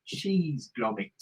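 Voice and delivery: drawn-out Yorkshire cartoon voice; Yorkshire accent. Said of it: "That's a Yorkshire accent".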